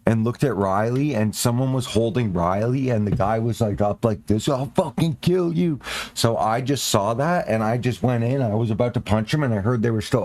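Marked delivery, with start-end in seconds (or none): 4.5-5.8 angry threat. Retold or imitated. Retold threat at concert fight.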